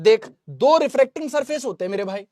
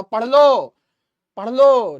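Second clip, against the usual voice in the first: loud command; 8 dB louder, much slower than usual. Real, not acted. Teacher scolds class to study.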